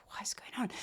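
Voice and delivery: whispered disbelief at her clumsiness. Retold or imitated. After spilling tea on her desk, whispered disbelief. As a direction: hush it, stunned by the spilled tea. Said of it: "And I'm like".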